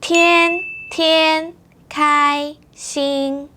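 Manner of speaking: 天天开心 drawn out, high level tones; very slow model. Shown as a demonstration. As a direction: stretch every syllable high and even. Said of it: "now it's your turn".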